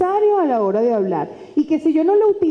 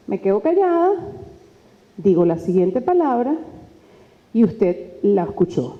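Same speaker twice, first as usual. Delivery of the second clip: long silences between phrases; deliberate pauses; pauses for emphasis; 4 semitones lower than usual. Shown as a demonstration. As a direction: leave a pause before the next word. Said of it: "¿Vieron cómo lo hice".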